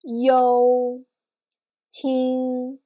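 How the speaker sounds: sustained level tone on "you" and "ting"; first tone. Shown as a demonstration. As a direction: say "you", then "ting" on one flat pitch. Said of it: "that's what we call the first tone".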